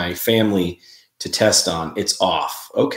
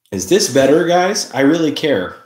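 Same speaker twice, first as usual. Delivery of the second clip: excited; 7 semitones higher, 5 dB louder than usual. Real, not acted. Asking viewers about lighting.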